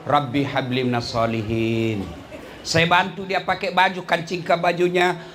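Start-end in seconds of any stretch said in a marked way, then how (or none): 0.1-2.0 low, hushed Arabic prayer; whispered blessing while stroking the head. Acted. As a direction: breathe the prayer over the child. Prayer while combing hair.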